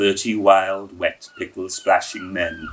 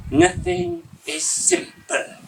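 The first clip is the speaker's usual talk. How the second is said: much higher, slow, mock-dignified; with dignity; slower than usual. A narrator's reading. Soaked Mister Franklin climbing out, mock dignity. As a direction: say it with wet, drunken dignity. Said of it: "with dignity".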